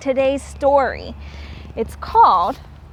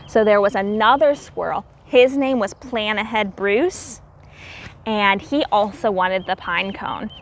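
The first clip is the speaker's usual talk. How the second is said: ordinary delivery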